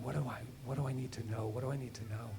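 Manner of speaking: soft, quick pleading under the breath; muttering to himself. Retold or imitated. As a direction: press the question quietly inward.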